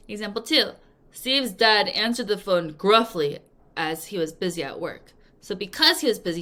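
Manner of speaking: ordinary talk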